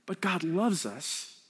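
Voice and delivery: ordinary delivery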